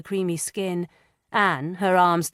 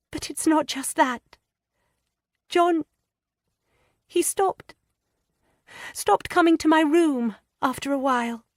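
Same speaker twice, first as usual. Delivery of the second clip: put-on high, hesitant girlish voice, stammering; a frightened young wife struggling to confess; young distressed woman; 9 semitones higher than usual. A narrator's reading. Lydia hesitantly confiding her fears to Anne.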